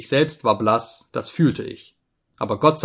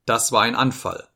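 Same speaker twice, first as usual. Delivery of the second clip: usual speaking voice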